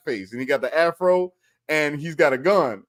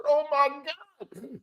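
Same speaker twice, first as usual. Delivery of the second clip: high, drawn-out laughing gasp; helpless laughter; 12 semitones higher, 6 dB quieter, much slower than usual. Real, not acted. Comedian cracking up at his own story, high and helpless. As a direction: squeeze it out slowly through laughter.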